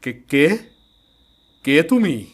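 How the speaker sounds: lightly voiced investigator. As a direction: call out, then lay out the legend. Investigator reacts and explains.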